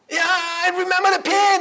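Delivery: high-pitched, gushing wife's reply; eager wife. Acted. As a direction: brush off the pain excitedly. Wife eagerly answering her husband.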